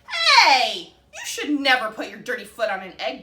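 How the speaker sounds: loud, laughing scold, voice up. A narrator's reading. Duck scolding Goose about his dirty foot, loud laughing rebuke. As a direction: shout the telling-off with a laugh. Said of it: "Duck scolded".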